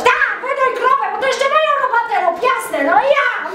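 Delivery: high mocking housewife voice; sarcastic wife. Wife mocks her husband going off to the cow, sarcastic. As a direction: sneer at him in a high sharp voice.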